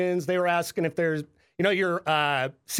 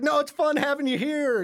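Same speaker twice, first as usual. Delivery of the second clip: pleading parent; 11 semitones higher than usual. Retold or imitated. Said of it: "It's like".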